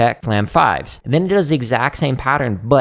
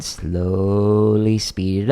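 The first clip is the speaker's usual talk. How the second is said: stretched, slow delivery miming the tempo; illustrative slow-down; 3 semitones lower, much slower than usual. Shown as a demonstration. Drum teacher advising gradual tempo building, slow and drawn out. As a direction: draw out "slowly" very long. Draws out the word slowly to illustrate.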